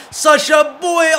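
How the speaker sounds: very loud, very high, slow yell; drawn-out shout. Real, not acted. Gamer screaming his striker's name as the chance goes. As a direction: stretch the name out in a huge shout. Big chance missed in game.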